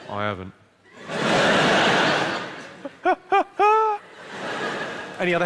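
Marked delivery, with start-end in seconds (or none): 0.1-0.5 low, understated denial; mock low reply. Comic answers the schoolboy's heckle, mock low denial. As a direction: drop low to deny it.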